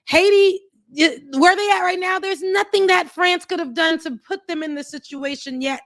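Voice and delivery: a hint of French accent, high and mocking. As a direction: tease them in a hammed-up French accent. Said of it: "They American Frenchy accents".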